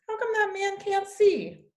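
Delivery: put-on high, small child's voice; a boy asking his grandma about the blind man, in a high child's voice; little boy. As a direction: ask it like a curious young boy. Young boy CJ asks grandma.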